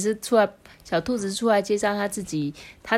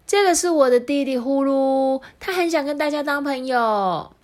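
higher, bright little sister voice; Yaya introducing; 8 semitones higher, 4 dB louder than usual. Acted. Yaya to the animals, a higher girlish voice. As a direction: introduce your brother to new friends.

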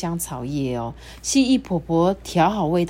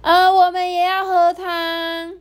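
high, louder, drawn-out plea; high slow chorus of eager animals; 10 semitones higher, 4 dB louder than usual. A narrator's reading. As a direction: clamour together for soup. Animals begging for soup.

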